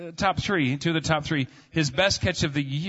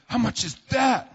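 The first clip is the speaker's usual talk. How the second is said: put-on little boy asking; 8 semitones higher than usual. Kid shopping at dollar store.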